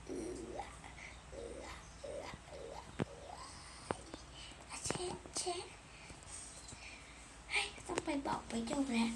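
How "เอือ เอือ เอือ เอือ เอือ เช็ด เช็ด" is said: straining grunts. Pretend. Straining on the toilet.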